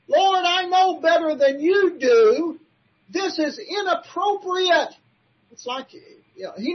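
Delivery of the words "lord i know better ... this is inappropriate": lightly voiced haughty Peter, higher and slow; self-righteous Peter. Preacher mocking Peter telling Jesus he knows better, higher. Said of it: "it's like him saying".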